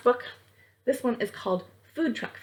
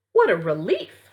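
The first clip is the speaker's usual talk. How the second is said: louder, relieved sigh of words; relieved exclamation; 7 dB louder than usual. Acted. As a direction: let the relief burst out. Snacks relieved after kid leaves.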